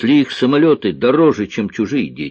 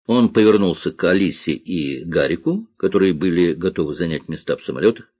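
usual speaking voice

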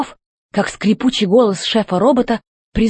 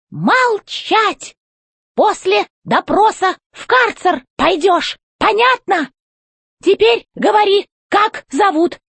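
high, slow, commanding robot boss; robot chef voice; 10 semitones higher than usual. A narrator's reading. Robot chef demanding answers from the old robot, high and commanding. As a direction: bark orders from up high like a tin general.

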